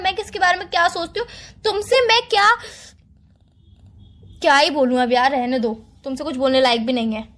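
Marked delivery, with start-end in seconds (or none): 1.6-2.5 louder, higher exasperated protest; exasperated outburst. Acted. Girlfriend protesting he never understands her, exasperated. 4.4-7.3 lower, fed-up dismissal; exasperated resignation. Acted. Girlfriend giving up on explaining herself, resigned. Gives up arguing after outburst.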